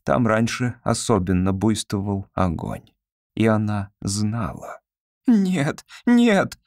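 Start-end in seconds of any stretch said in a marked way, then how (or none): 5.3-6.6 crying out. A narrator's reading. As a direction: cry out in panic. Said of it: "вскричала она".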